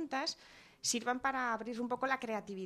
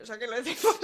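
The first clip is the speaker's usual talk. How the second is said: loud laughing through the words; 4 semitones higher, 10 dB louder, much faster than usual. Real, not acted. Host laughing at parents yelling not to yell, laughing loudly. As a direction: laugh at the irony as you speak. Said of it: "Dando ejemplo".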